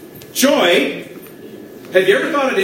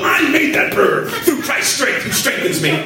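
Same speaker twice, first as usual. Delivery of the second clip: mock proud boast; 4 semitones higher than usual. Acts out boasting over his burger.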